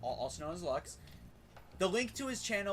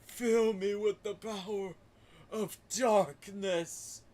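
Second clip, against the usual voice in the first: drawn-out villain voice, aiming deeper; Ansem; 2 semitones higher, slower than usual. Acted. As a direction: intone it slowly, like a grand dark villain. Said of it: "you gotta go deeper".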